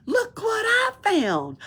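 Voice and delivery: high, slow, delighted; delighted find. Acted. Pippi proudly showing the nightshirt, high and delighted. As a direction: show off your treasure.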